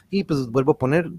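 ordinary delivery

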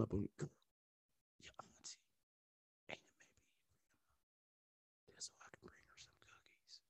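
sly joking whisper. Acted. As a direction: slip the joke in under your breath. Whispered joke aside.

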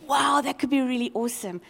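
normal talking voice

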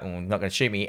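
low, soft aside in the killer's head; quiet, retold thought; muttered inner thought. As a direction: say it low and under the breath. Voicing the killer's private thought.

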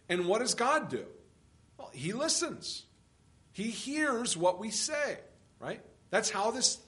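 ordinary talk

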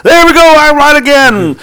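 high, loud and slow triumph; drawn-out victory crow; gloating. Real, not acted. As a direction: savour being right. Gloating about being right again.

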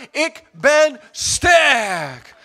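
very slow, loud, high shout; drawn-out shouted I am strong; bold declaration. Pretend. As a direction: proclaim it like a victory cry. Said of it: "Laat de zwakken zeggen".

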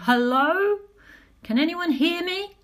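loud, high call into the dark. A narrator's reading. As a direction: call out into an empty cave.

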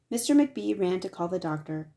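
ordinary delivery

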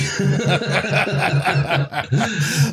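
long rolling laugh; sustained amused laughter; real laugh. Real, not acted. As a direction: crack up at your own joke. Laughs at his own cowboy joke.

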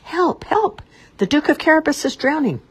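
high, loud cry of alarm; desperate shout for help. A narrator's reading. Cat shouting to the passing king, high and loud. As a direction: yell as if your master is drowning. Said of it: "Puss shouted at the top of his voice".

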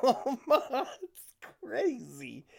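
laughing in disbelief; slow words through a laugh. Real, not acted.

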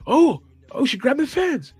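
high, loud excited yell; mock yell. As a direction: imitate the commentator yelling it out. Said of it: "like, yells".

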